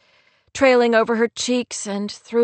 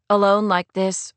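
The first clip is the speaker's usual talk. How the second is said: usual speaking voice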